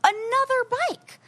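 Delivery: high, drawn-out incredulous emphasis; can-you-believe-it storytelling outrage; exasperated disbelief. Retold or imitated. Retelling a dreaded repeat climb.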